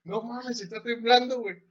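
high mock yell of panic; scared friends yelling as the quake hits, high mock panic; retold panicked yelling. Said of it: "Empezamos a gritar".

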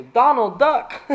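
slow, loud high yell of "Donald Duck!". Acted. Secret Service agent warning the president, slow shout. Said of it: "isn't allowed to yell".

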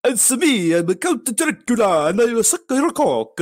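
high, hammed-up Dracula voice; Count Dracula. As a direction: play a campy vampire introducing himself. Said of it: "Count Dracula, coming in the studio".

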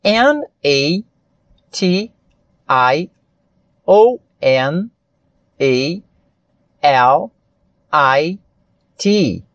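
letter names in English, one by one with pauses; measured letter-by-letter dictation in English; spelling in English. Shown as a demonstration. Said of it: "Eu vou fazer em inglês".

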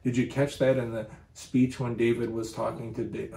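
ordinary delivery

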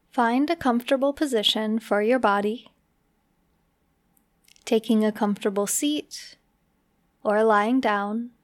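unhurried meditation guide with long pauses; measured, spacious delivery for relaxation; guided meditation. Acted. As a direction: ease the listener slowly into relaxing.

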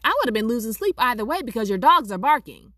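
loud, high, fast comeback; heated retort. Retold or imitated. Retold quarrel, snapping back at neighbor.

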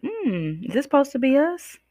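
put-on high, puzzled child's wondering; puzzled question. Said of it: "And I was like".

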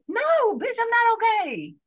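high, loud mock outburst; hammed-up furious reply, high and loud; mock shout. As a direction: shout it back as the hurting dancer. Ballerina answering in mock anger.

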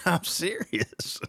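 soft, slow, laughing I'm serious. Real, not acted. Host insisting to the guest while laughing, laughing. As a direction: say it through a laugh. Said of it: "You laugh".

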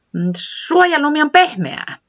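high little voice; hint with a high voice; Tiputi hinting. Acted. Tiputi still hinting to Säämies, high.

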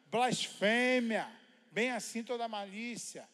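slow, loud, word by word proclamation; preached scripture reading. Acted. Pastor reading the list of vices, slow and loud. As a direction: hammer each vice out slowly.

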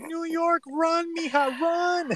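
high, half-crying urge to flee; mock panicked warning. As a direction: yelp it like a horror-movie warning. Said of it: "I was like".

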